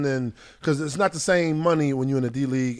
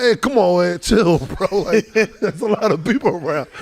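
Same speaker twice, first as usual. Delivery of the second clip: raised, urging him to relax; pleading calm down; 5 semitones higher than usual. Retold or imitated. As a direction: talk a hothead down. Pleading with yelling Oakley.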